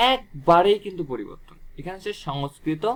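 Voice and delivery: ordinary talk, nothing marked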